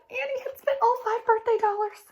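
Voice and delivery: mock dismay. Dramatic realization in story.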